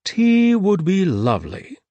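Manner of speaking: high, loud and slow cheerful host; delighted gentleman voice; beaming Thomas. A narrator's reading. The host beaming at the trio, high and delighted.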